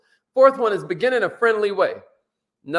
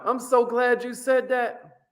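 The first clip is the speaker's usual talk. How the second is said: higher, slow and pleased; glad agreeing tone; 5 semitones higher, slower than usual. Shown as a demonstration. Salesman to customer, slowly and gladly agreeing. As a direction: sound truly glad they objected. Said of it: "you gotta be like".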